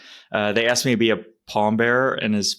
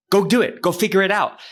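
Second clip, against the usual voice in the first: higher, urging mentor voice, a bit louder; mentor's push; 9 semitones higher, 3 dB louder than usual. Retold or imitated. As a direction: push him like a blunt mentor. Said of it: "He's like".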